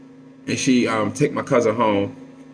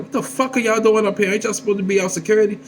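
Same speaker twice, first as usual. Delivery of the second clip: a lightly voiced grandma, pitched higher and fast; grandma scolding; 11 semitones higher, faster than usual. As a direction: scold them like a fed-up grandma.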